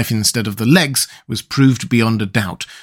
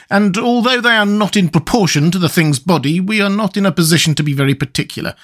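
reasoning Woodman, pitched up; lightly voiced Woodman; 6 semitones higher than usual. A narrator's reading. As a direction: explain it in a lightly raised character voice.

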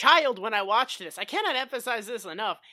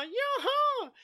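put-on very high childish comeback; bickering imitated high retort; mock insistence. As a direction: squeal back the contradiction. Acts out childish back-and-forth insistence.

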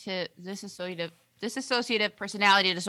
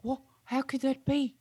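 lightly put-on English accent, higher; a hint of a befuddled Englishman; mild English accent, confused; 6 semitones higher, 7 dB quieter than usual. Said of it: "He talks like that. ... I got an English accent".

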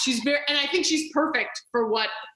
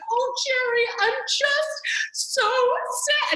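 much higher, very slow fake sob voice; mock crying; 13 semitones higher, much slower than usual. Tennis player fake-crying on the phone to Jerry, much higher whimper. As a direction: ham up a whiny fake weep. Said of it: "she starts crying on the phone to him".

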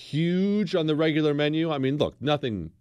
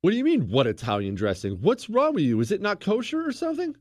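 exasperated disbelief; 11 semitones higher than usual. Acted. Host scolding Chris for not knowing the dressing, in high exasperated disbelief. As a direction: squeal it like he can't believe anyone missed it. Berating Chris about Olive Garden.

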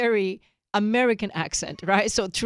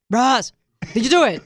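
louder, pushier repeat of the question; louder demand; 3 semitones higher, 8 dB louder than usual. Retold or imitated. Wife mimicking husband demanding louder from another room. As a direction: call it up the stairs, losing patience.

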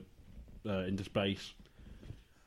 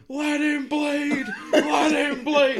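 high, slow, hammed-up wail; drawn-out imitation of a raving wrestler; mock yelling; 13 semitones higher, 7 dB louder, much slower than usual. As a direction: mimic a cartoon meltdown at full volume. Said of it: "Stage just started going".

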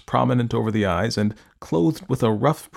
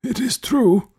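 put-on high, slow, stern old man voice; imperious elderly recluse, demanding; 13 semitones higher, slower than usual. A narrator's reading. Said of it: "he said".

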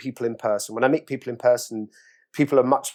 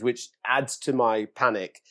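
normal talking voice

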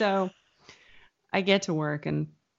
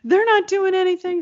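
much higher, laughing mock mother; freaking-out mom; 12 semitones higher, 4 dB louder than usual. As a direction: play the frantic mother, high with a laugh. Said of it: "she's like".

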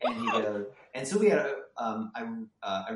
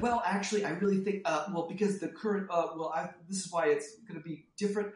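higher, stammering attempt to save the pitch; flustered salvaging; 8 semitones higher, 3 dB louder than usual. Writer trying to save the pitch, flustered and higher. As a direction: scramble to rescue it, pitched up. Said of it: "salvage it and just be like".

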